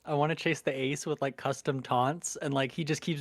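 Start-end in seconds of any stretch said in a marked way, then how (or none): none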